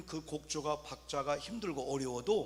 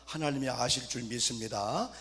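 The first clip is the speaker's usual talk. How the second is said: ordinary delivery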